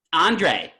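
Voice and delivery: high, loud retold call. Storyteller calling the clerk after the customer leaves, calling out. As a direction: hail the clerk across the store.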